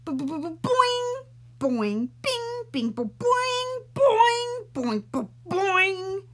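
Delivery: high loud springy boings; boing sound effect. Acted. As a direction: bounce each boing like a spring. Hair springs loose noisily.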